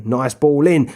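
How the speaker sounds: ordinary delivery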